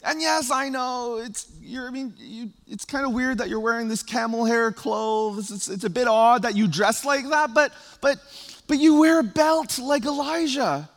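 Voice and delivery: high, loud, eager pleading to John; excited crowd pleading. Retold or imitated. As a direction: plead with him, half joking, wholly thrilled. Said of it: "they were probably thinking and feeling".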